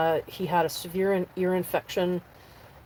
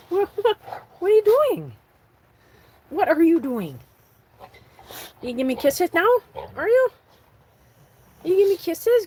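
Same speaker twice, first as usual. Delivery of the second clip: much higher and louder, delighted cooing; 11 semitones higher, 6 dB louder than usual. Real, not acted. Owner delighted by her dog's kisses, squeaky high baby talk. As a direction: gush at the dog as he gives kisses.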